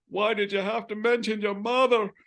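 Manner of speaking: gasping, high, loud wounded father; Mike Myers. Retold or imitated. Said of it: "that would irritate Mike Myers".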